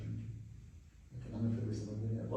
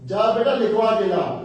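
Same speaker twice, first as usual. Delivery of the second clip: high, loud urgent order; father ordering his son loudly; loud command; 10 semitones higher, 14 dB louder than usual. Acted. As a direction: send the boy off firmly.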